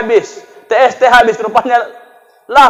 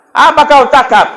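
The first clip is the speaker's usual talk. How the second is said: mock taunt; 7 semitones higher, 6 dB louder than usual. Wife taunting stroked husband.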